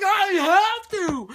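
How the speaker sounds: high, loud, stretched cry; hammed-up anguished yell; screaming. He insists he must let go, screaming. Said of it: "so they're screaming".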